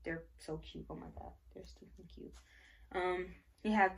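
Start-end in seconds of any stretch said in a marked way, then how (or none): 0.0-2.3 whispering. Real, not acted. Gushing over baby clothes.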